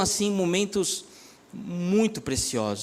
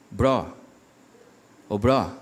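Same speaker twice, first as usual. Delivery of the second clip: much lower, slow calling voice from above; deep divine voice; 9 semitones lower, slower than usual. Retold or imitated. Pastor voicing God calling him from a fire sensor, deep and slow. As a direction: call out low like God through a speaker. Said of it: "dali sai uma voz assim".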